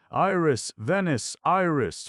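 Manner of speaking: high, loud desperate calls; desperate loud calling. Acted. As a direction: shout the names into the night. Calling lost swimmers.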